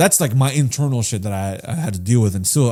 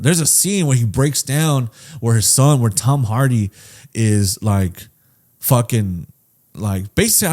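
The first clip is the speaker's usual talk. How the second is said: usual speaking voice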